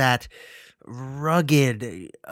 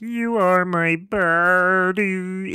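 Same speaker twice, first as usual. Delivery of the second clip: slow, drawn-out mock robot-doll voice; lightly imitated killer buddy doll; mocking Mark Hamill's Chucky; 4 semitones higher, much slower than usual. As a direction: parody the dull toy line, slowly. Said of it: "and he's like".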